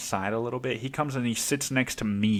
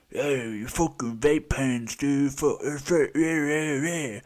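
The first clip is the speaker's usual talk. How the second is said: burly guy; 4 semitones higher than usual. Retold or imitated. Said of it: "the big burly Johnny fucking".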